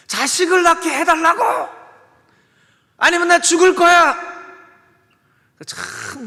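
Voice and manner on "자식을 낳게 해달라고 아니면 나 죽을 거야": much higher, loud shrieking demand; a wife screaming at her husband in despair; Rachel's desperate outburst. Acted. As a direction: Rachel screaming at Jacob for a child, high and loud. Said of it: "라헬이 얘기합니다".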